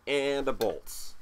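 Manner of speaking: slow, savoured Bolts; relishing Bolts. Real, not acted. Jersey collector enjoying the team name, slow relish. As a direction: stretch Bolts out for fun. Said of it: "fun to say Bolts".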